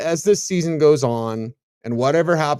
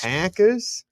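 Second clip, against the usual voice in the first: higher, questioning try at a Wisconsin "Packers"; attempted Wisconsin accent; 5 semitones higher than usual. Shown as a demonstration. Host attempting the co-host's Packers, tentative Wisconsin accent. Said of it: "say it like a Wisconsinite".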